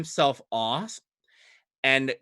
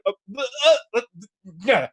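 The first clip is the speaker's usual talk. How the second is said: flustered fumbling; 8 semitones higher, much faster than usual. Acted. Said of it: "And you're like".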